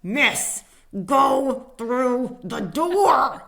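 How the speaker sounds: drawn-out pointed instruction; emphatic attendant. Retold or imitated. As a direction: spell it out for her slowly. Attendant insisting, with laugh.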